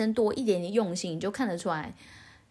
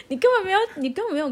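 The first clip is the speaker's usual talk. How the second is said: laughing, much higher and louder; 11 semitones higher, 7 dB louder, much faster than usual. Real, not acted. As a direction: laugh it out while calling his bluff. Teasing partner, laughing.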